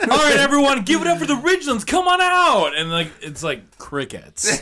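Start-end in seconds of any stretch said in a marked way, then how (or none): 0.1-2.8 mock stage announcer. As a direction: hype up the band like an MC.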